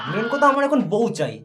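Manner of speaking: normal talking voice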